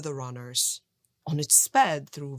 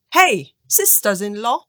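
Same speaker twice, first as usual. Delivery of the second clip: louder call across the yard; shout; 9 dB louder than usual. A narrator's reading. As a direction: shout out so they hear you outside. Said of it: "he shouted".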